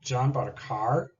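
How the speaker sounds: "car" rises, turning a statement into a question; a slow statement that lifts up at the end; rising statement question. Shown as a demonstration.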